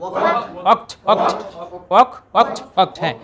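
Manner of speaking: "ওয়াকড" said as "ওকড", no r sound, ending in t; teacher models "ওয়াকড" with open o and no r; right pronunciation. Shown as a demonstration. Bangla English teacher drilling students: "ওয়াকড" spoken as "ওকড". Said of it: "বলেন ওয়াক".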